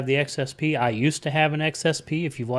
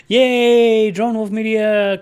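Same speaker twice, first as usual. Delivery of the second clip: loud, high, drawn-out whoop of welcome; excited cheer; 10 semitones higher, 10 dB louder, much slower than usual. Real, not acted. Streamer greeting a late-arriving viewer with an excited cheer. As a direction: cheer the newcomer in at full voice.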